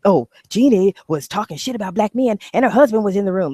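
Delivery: lightly voiced tattling commenter; mock accuser. Mocking people who falsely accuse her, light mock voice. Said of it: "Like, you know".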